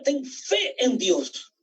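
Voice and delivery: very slow, soft-edged emphasis; slow personal charge. Acted. Preacher tells each church member by name, slowly. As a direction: hand each word to them slowly.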